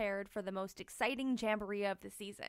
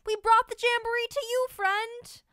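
high, loud excited call; excited friend; 12 semitones higher, 9 dB louder than usual. Acted. Friends surprising Tank with the jamboree, high and loud. Friends arriving with party bus.

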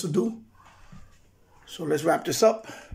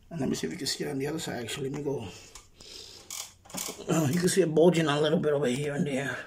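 shaky voice up a ladder; talking while balancing on a ladder; 3 semitones lower, 6 dB quieter, faster than usual. Real, not acted. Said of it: "I'm on the ladder right now, so I'm sorry if I'm shaking".

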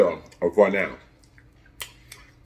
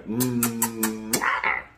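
blown kiss; 6 dB quieter than usual. Real, not acted. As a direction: blow the camera a kiss. Blowing kisses to new viewers.

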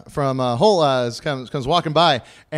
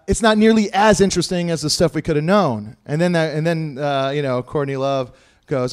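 ordinary talk

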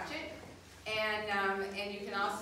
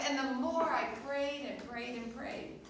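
normal talking voice

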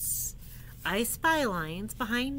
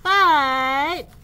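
building elephant wail; 6 semitones higher, 13 dB louder, slower than usual. Acted.

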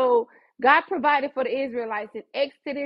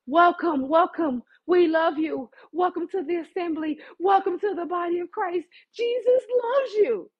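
gushing welcome; 5 semitones higher than usual. Acted. Welcoming scoffers into the assembly, gushing. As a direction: welcome them in, higher and higher. Greeting the mockers who come in.

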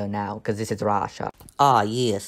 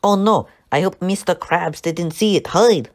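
higher and louder sudden alarm; mock panic; 7 semitones higher, 5 dB louder than usual. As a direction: yelp it as if caught red-handed. Panics at Mister Krabs.

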